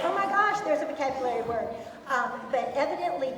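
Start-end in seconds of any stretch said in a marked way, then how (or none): 0.0-1.7 higher, much louder excited outburst. Retold or imitated. Teacher recalling spotting a vocabulary word, excited. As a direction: retell a thrilled reaction at full volume. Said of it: "I was so excited. I was like".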